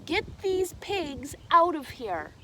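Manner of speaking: loud, high, slow scolding; yelling father. A narrator's reading. Father orders Megan to get the pigs out, slow loud scolding. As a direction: scold her slowly and loudly. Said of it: "yelled her father".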